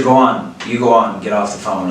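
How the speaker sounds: ordinary talk, nothing marked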